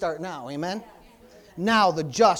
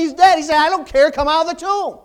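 loud, high preaching burst; full-force preaching; 7 semitones higher, 11 dB louder than usual. Acted. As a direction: thunder it out from the pulpit.

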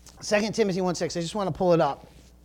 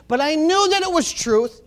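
higher, louder preaching voice; fired-up preaching; 7 semitones higher, 8 dB louder than usual. Real, not acted. Preacher stirring up the congregation, fired-up. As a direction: preach it with rising fire.

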